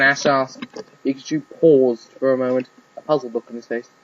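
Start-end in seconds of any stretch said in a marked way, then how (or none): none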